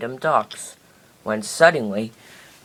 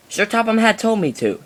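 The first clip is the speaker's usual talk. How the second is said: snapped; 9 semitones higher than usual. A narrator's reading. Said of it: "snapped Diesel".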